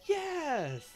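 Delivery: high, loud thrilled yes; excited yes. Retold or imitated. Fan cheering at the Samus mask, high excited shout. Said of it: "and it's like".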